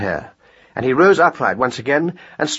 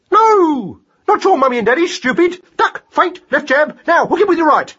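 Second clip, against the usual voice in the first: high, loud, exasperated hare; impatient mad hare; 4 dB louder than usual. Acted. Boxing hare corrects mouse, impatient and high. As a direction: correct him impatiently, then call punches.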